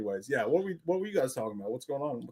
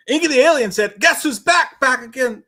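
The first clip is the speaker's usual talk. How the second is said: loud, high hype read; excited; 8 semitones higher, 9 dB louder than usual. Real, not acted. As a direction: announce it like a hype man. Excited reading of a member alert.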